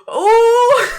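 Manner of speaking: loud high shocked "oh!"; shocked cry. Real, not acted. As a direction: cry out at the burn. Reacting to the burn joke.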